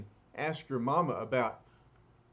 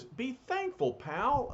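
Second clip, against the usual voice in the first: higher tough-guy retort; lightly voiced tough guy; 11 semitones higher, slower than usual. Ben answering the angry old man, lightly voiced. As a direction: talk down to him like a bruiser.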